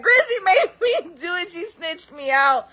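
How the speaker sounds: high whine breaking into a laugh; whiny friend. Retold or imitated. Friend blaming Grizzy, high whining and laughing. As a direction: whine and blame, laughing through it. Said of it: "She's like".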